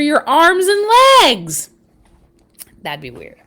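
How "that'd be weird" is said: ordinary delivery, nothing marked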